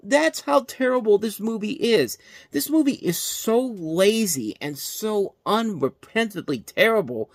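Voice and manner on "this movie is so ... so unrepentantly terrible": ordinary talk, nothing marked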